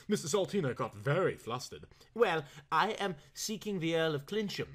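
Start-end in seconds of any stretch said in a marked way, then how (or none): 2.1-4.7 slightly trembling, hesitant voice; trembly voice. A narrator's reading. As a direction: let the voice waver timidly. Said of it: "in a trembly voice".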